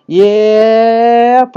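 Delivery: a high, loud, drawn-out yell of "Yep!"; Dave Hester yep. Retold or imitated. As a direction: belt out the catchphrase high and long. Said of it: "sorry for using your yep".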